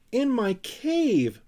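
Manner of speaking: high, slow outraged scream. Acted. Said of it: "screamed Sniff".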